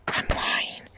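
breathy whispered aside. Real, not acted.

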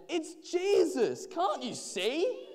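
high, loud, stretched-out reply; crowd shouting back. A narrator's reading. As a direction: shout it slowly over the din. Excited crowd answers the blind man.

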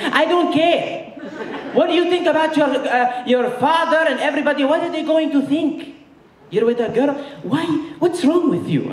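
put-on high, accented, scolding mother voice; angry Egyptian mom. Mom scolding son about dating, high dramatic accented voice.

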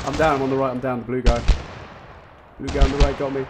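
loud, high callout after going down; man down shout. Real, not acted. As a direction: yell to your teammate you're down. Downed in game, calling out.

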